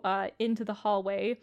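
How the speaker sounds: usual speaking voice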